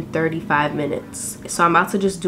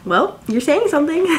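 high, teasing question; retold coy comeback, pitched up; playful coy reply; 7 semitones higher than usual. Woman retelling her reply to an admirer, playfully coy.

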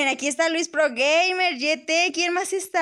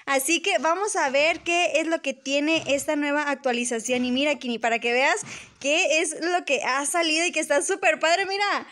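ordinary delivery

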